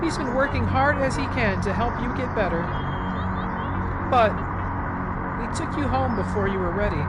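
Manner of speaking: put-on firm but pleading, a mother locking her child away; stern mother. Voices Mother figure reading game text.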